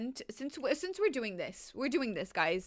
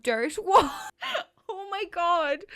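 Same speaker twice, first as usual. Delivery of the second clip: loud, high laughing exclamation; crying with laughter; 8 semitones higher, 7 dB louder than usual. Real, not acted. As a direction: burst out laughing mid-sentence. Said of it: "I'm crying".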